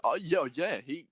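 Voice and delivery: usual speaking voice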